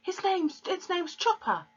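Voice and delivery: put-on high, proud little boy's voice; small boy. A narrator's reading. Fred showing Matilda his parrot, high proud boy voice. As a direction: show it off like a six-year-old.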